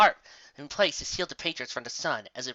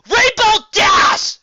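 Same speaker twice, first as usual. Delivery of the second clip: much higher, slow, loud bellow; 15 dB louder, much slower than usual. A narrator's reading. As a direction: bellow her name across the sky. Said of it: "she bellowed".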